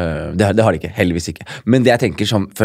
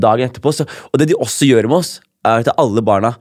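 usual speaking voice

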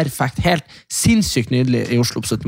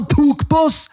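put-on whiny teen accusation; 13 semitones higher than usual. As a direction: play an upset teenager telling on someone. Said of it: "De bare sånn". (sutrete tenåringsstemme)

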